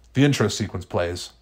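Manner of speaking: ordinary delivery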